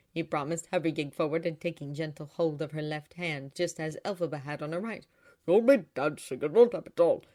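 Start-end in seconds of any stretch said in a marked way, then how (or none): 5.5-7.2 loud, exultant father's voice; eagerly jubilant. A narrator's reading. Father promises his daughter dancing, eagerly jubilant. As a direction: proclaim it loudly, full of eagerness. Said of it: "Frex was eagerly jubilant".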